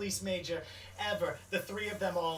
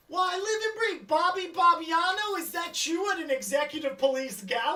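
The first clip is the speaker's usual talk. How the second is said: high, loud, delighted falsetto gush; high-pitched overjoyed society lady; Ronnie's gushing greeting; 12 semitones higher, 9 dB louder than usual. Acted. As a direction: squeal hello at an old friend across the room. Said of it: "Ronnie, turns and notices you staring and goes".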